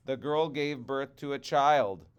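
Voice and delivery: ordinary delivery, nothing marked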